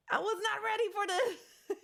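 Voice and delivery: high-pitched, laughing protest. Real, not acted. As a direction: protest helplessly through laughter. Laughs at own overwhelmed state.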